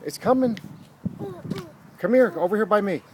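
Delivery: excited urging. Real, not acted. Urging child as train nears.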